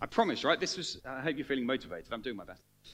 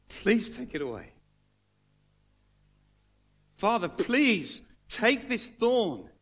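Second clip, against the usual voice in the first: pleading prayer; 2 semitones higher than usual. Retold or imitated. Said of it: "he's crying out to God".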